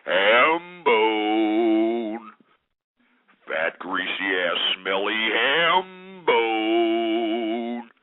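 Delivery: a slow, deep, dragged-out jeer at a passing stranger; mock insult. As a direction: growl it out deep and slow like a schoolyard taunt. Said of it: "just say".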